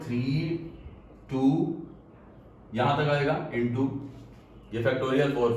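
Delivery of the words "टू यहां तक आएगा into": normal talking voice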